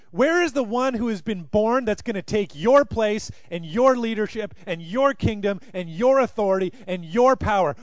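loud, high, pressing challenge to a king; forceful challenge. Acted. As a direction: confront him head on, building pressure. Magi voiced confronting Herod.

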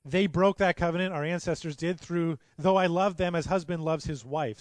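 ringing pulpit reading of scripture. Acted. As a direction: proclaim the prophecy with rising fervor. Quoting Jeremiah, raised.

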